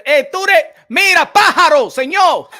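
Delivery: loud, high, brash call-out; bold loud call. Retold or imitated. The way men used to call it out, loud. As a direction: shout it across the room. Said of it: "Before le diciendo".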